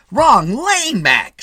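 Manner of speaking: high, loud rebuke; parking attendant barking at Mitty; loud scolding. A narrator's reading. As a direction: call him out sharply.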